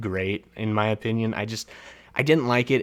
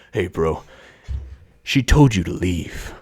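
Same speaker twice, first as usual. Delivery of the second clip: lower, mock tough-guy warning; tough neighbor bro; 4 semitones lower, 5 dB louder than usual. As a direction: puff up like a self-appointed bodyguard. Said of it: "he's like".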